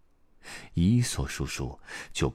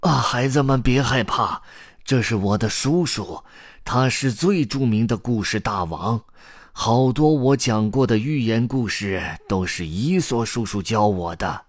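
put-on raised, higher old-man voice; grandpa's eager, loud explanation; calling out; 6 semitones higher, 6 dB louder than usual. A narrator's reading. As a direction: introduce him loudly and excitedly. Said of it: "故事爷爷喊道".

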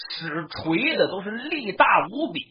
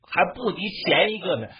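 usual speaking voice

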